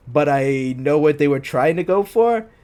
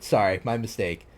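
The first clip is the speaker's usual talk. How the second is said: ordinary delivery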